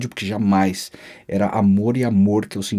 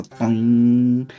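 sustained "doing" imitating a guitar note; nota de guitarra imitada. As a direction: hum out one long plucked-string note. (imitando nota de guitarra)

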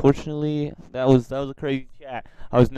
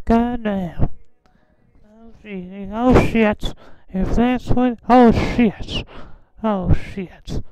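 much higher put-on old man voice; Herbert voice; 9 semitones higher, slower than usual. Teasing strangers on video chat in high old man voice. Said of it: "a glimpse of my Herbert voice".

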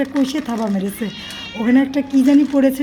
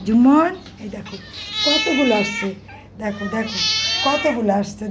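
usual speaking voice

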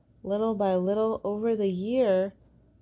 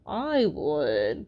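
creaky coral voice; 5 semitones lower, 4 dB louder than usual. Acted. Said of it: "creaked a crusty coral".

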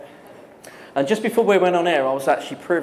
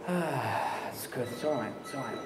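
much softer, relieved exhale; relieved sigh; 14 dB quieter than usual. Retold or imitated. Studio staff relax as the singer starts self-censoring, softly relieved. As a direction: let the tension drop out, hushed. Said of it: "shoulders just go".

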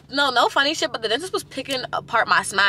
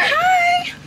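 squeaky hi; 4 dB louder than usual. Real, not acted.

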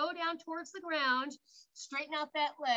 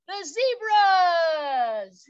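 loud, drawn-out excited announcement; big reveal; 4 semitones higher, 12 dB louder, much slower than usual. Real, not acted. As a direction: stretch it out like a showman's reveal. Big reveal of the animal riddle.

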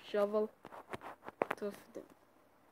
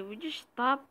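ordinary delivery

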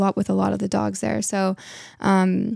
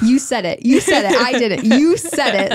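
louder, laughing teasing; playful teasing; 4 semitones higher, 6 dB louder than usual. Real, not acted. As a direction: rub it in with a laugh.

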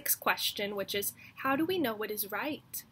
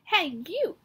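loud, higher shout-out; bossy call; 6 semitones higher, 5 dB louder than usual. Acted. Mouse hailing the lizard, loud and cocky. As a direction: call across to a stranger, cocky. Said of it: "said the mouse".

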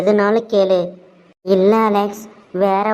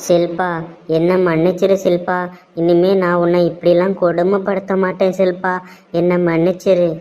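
usual speaking voice

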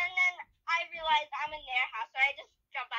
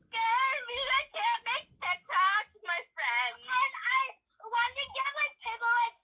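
whiny fake cry; 7 semitones higher than usual. Diva whining about TikTok.

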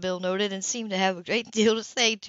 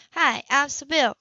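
light Sybil voice; 6 semitones higher, 3 dB louder than usual. Acted. Sybil introduces herself.

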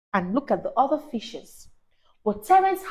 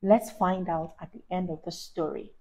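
ordinary delivery

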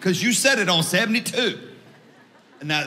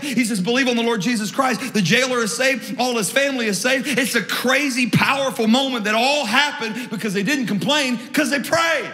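high, driving sermon storytelling; raised pitch, rolling pulpit momentum; impassioned preaching; 5 semitones higher than usual. Real, not acted. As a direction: preach the miracle with mounting excitement. Preaches story at full force.

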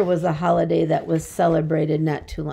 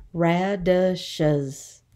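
"Radishes" broken into ra-di-shes; three evenly separated beats on "Radishes"; syllable by syllable. Shown as a demonstration. Teacher showing three syllables, "Radishes" said in parts.

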